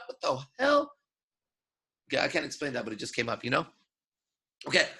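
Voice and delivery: ordinary delivery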